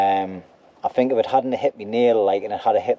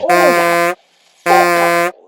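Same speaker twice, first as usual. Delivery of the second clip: high, loud, strained yelp of pain; real pain, the sting hitting hard; crying out in pain; 7 semitones higher, 8 dB louder than usual. Real, not acted. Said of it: "that's fucking stinging like mad".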